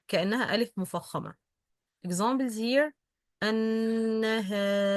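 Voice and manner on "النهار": النهار with a light, thin alif; correct alif. Shown as a demonstration. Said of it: "This is the correct pronunciation". (النهار بألف مرققة)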